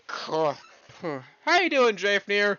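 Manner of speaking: high, loud, slow, stretched greeting; excited greeting. Real, not acted. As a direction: sing out a big hello to a viewer. Greeting a chat member.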